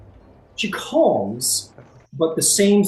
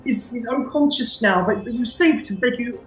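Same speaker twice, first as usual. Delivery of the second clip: high-pitched grateful Englishwoman; English village woman, voice lifted high; English accent; 11 semitones higher than usual. Acted. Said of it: "I don't know why I'm going English".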